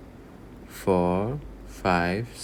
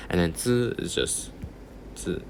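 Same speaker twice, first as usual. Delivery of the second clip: ordinary delivery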